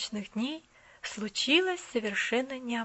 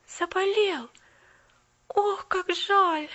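higher, very slow lament; moaning; 8 semitones higher, 4 dB louder, much slower than usual. A narrator's reading. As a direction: groan it in sorrow. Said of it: "простонала Полианна".

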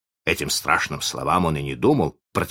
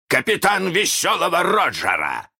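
high, proclaiming captain's voice; Captain Hook; 13 semitones higher, 4 dB louder than usual. A narrator's reading. Peter poses as Hook, high grand mimicry. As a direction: announce the title grandly, pitched up. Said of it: "ответил голос".

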